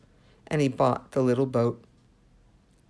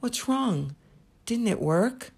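concerned mother; 8 semitones higher than usual. Acted. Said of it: "his mother asked".

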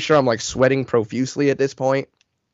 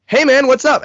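imitated cheery greeting; 12 semitones higher, 5 dB louder than usual. Friend answers the phone cheerily.

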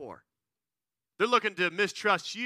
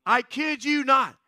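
ordinary talk, nothing marked

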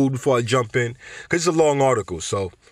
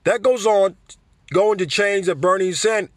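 higher, fumbling Bernie voice; Bernie accent; 7 semitones higher than usual. Retold or imitated. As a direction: put on Bernie and trip over the words. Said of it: "I can't even do the accent".

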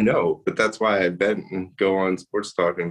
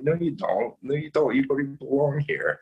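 higher-pitched mock argument; indignant retort; 8 semitones higher than usual. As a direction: argue back at an imagined smug jerk. Acted retort to an imagined jerk.

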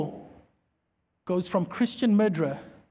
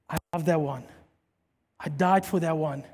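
tender Jesus voice; 6 semitones lower, 6 dB quieter than usual. Retold or imitated.

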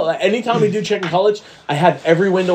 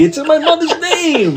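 much higher, slow, breaking into laughter; laughing mobster quote; 9 semitones higher than usual. Retold or imitated. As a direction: repeat the line pleading and laughing. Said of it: "My favorite De Niro line".

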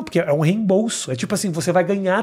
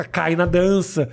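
usual speaking voice

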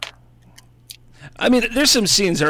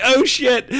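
mock shocked gasp; 10 semitones higher, 3 dB louder than usual. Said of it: "and you're like".

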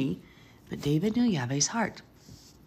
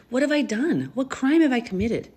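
high, louder desperate question; anguished plea; 8 semitones higher, 4 dB louder than usual. Acted. David begging Saul, high anguished plea. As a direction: cry out your innocence, high.

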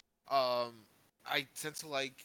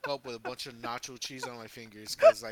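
loud storytelling breaking into laughter; laughing; 11 dB louder than usual. Real, not acted. Friend confesses his nacho mishap, laughing. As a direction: crack up while telling your own mishap.

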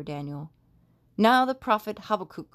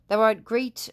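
loud, raised proclamation; loud shout; 3 semitones higher, 6 dB louder, slower than usual. A narrator's reading. King at the den praising God, loud cry. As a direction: call out in awe, full voice. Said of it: "shouted with a loud voice".

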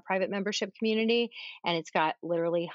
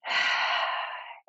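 audible sigh. Shown as a demonstration. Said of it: "you're doing that".

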